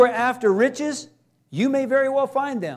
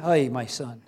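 tender fatherly voice; 10 semitones lower, 5 dB quieter than usual. Acted. God answers tenderly.

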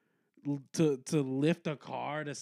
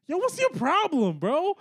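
high, quick mock-indignant objection; indignant protest; 10 semitones higher than usual. Bystander confronts the guy who went too far, indignant. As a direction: protest in a raised, hurried voice. Acted group protesting to the man.

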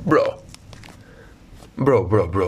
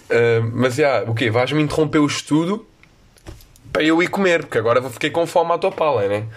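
ordinary talk

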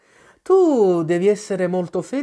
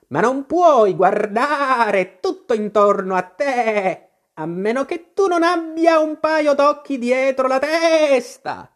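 much higher, slow bleating sheep voice; old sheep shopkeeper; 10 semitones higher, 4 dB louder, slower than usual. A narrator's reading. Old sheep shopkeeper asks Alice, slow bleating voice. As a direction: ask like an old knitting ewe behind the counter.

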